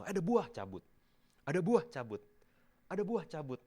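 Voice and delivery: "ada buah, cabut" said high and loud, eager and quick; brisk pulling off fruit. Shown as a demonstration. Preacher acts out the daily fruit-picking, high and loud. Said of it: "setiap hari keluar pagi terus lihat berbuah".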